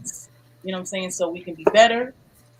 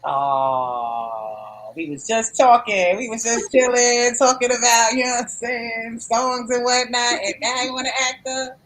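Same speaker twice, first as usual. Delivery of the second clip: higher, playful sassy tone; 6 semitones higher, 4 dB louder than usual. Pretend. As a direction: tease them with mock attitude.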